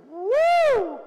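put-on high, loud delighted woo of a baby sliding down; toddler woo. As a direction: squeal it like a baby on a slide. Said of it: "and she goes".

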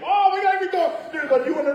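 mock panic, hurry it along; hammed-up urgency, pitched high; frantic hurry. Rushing ceremony before she dies.

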